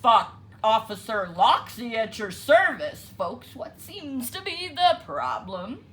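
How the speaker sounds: loud, hearty officer voice; fake officer. A narrator's reading. Fox in disguise greeting the birds as an officer. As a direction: play the friendly cop.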